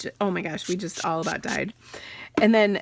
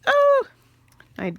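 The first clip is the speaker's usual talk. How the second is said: real high, loud yelp of surprise; sudden high-pitched "oh"; startled oh; 6 dB louder than usual. Real, not acted. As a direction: cry out suddenly at a small mishap. Mishap while crafting, startled cry.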